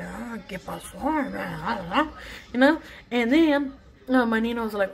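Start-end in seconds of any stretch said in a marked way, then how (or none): 0.0-2.1 slow, low, concerned Spanish aside; neighbor voice. Retold or imitated. Neighbor asking what happened, slow and low. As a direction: drawl it like the worried older neighbor. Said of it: "neighbor quoted".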